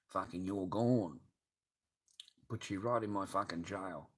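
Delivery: ordinary delivery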